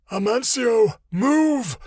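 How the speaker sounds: high, loud snarled command; snarling. A narrator's reading. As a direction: snap the order out with a snarl. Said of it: "he snarled".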